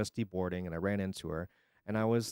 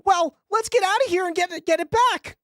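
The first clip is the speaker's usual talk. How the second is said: much higher, louder cartoon character voice; loud high cartoon villain; 5 dB louder than usual. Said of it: "I can't do this for the entire fifteen minutes".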